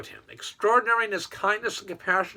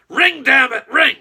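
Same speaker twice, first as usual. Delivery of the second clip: louder, higher urging at the phone; will the phone to ring, mock impatient; impatient plea; 4 semitones higher, 7 dB louder, slower than usual. Cartoon boss staring at his phone, louder mock urging. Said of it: "He says".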